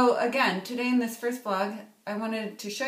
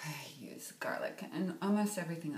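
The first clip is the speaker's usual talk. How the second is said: fast whisper, almost everything; confiding whisper; 4 semitones lower, 7 dB quieter, faster than usual. Real, not acted. Confessing her garlic habit to viewers, a quick whisper. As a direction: whisper it like a guilty secret.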